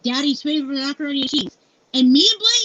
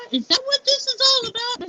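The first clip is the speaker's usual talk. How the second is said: high-pitched incredulous question with a laugh; retold disbelief; 9 semitones higher than usual. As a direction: mimic an astonished acquaintance, pitched way up. Acting the astonished store acquaintance.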